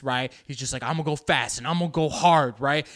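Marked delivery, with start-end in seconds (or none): 0.8-2.6 put-on pushy, louder teen bravado; punchy mock teen bravado; eager teenage boy. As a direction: act out an over-keen teenage boy.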